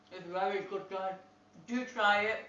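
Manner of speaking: talking through a mouthful of food; chewing while speaking; mouth full. Real, not acted. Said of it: "I'm talking with my mouth full".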